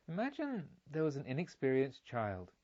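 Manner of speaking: ordinary delivery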